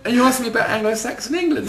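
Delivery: high, loud and laughing; mock him while cracking up; laughing mockery. Real, not acted. Mocking the skinhead to the interviewer, laughing high and loud.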